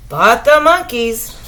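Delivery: plain reading voice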